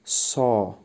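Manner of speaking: سا said slowly as saw, no w glide. Shown as a demonstration. Teacher modelling سا slowly for students. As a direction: say سا, open o without w. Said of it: "و نه ساو".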